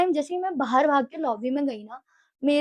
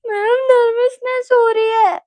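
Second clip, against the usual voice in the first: high, loud, drawn-out sob; imitated bawling; 9 semitones higher, 7 dB louder, slower than usual. Nervous student crying to ma'am, exaggerated bawling. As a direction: wail it through hammed-up tears. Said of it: "भैं-भैं करके रो रही हूँ".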